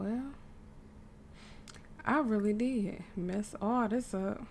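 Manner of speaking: normal talking voice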